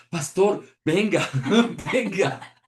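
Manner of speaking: high, loud, eager call with a laugh; calling the pastor over, delighted and laughing; excited calling out. Retold or imitated. Hostess joyfully calling the pastor to come, loud and high.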